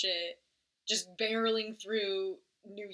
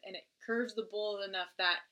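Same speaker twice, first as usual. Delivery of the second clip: ordinary delivery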